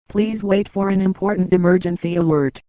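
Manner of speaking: ordinary talk, nothing marked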